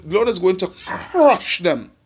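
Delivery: crush stretched out with a long grinding onset; stress on crush. Real, not acted. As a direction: lean hard and long on the word crush. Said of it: "I emphasize on that word crush".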